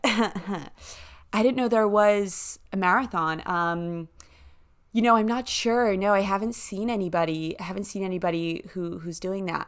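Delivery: put-on American accent for a local. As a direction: imitate an American woman's accent. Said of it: "let me do the accent".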